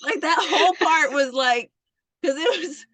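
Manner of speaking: ordinary talk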